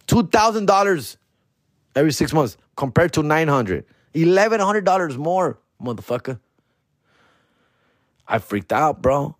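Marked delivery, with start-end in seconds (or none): none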